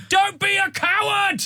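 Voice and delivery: slow, high-pitched mock bellow; aggressive parent yell. Imitating goalkeeper's parent on the sidelines, aggressive drawn-out yell. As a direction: draw the taunt out in a high shout. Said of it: "like, aggressive they get".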